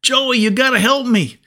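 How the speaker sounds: put-on scared little boy gasping for help; panicked boy crying out. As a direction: cry out to your big brother in terror. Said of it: "he cried out".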